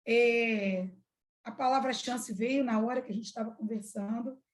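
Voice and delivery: ordinary talk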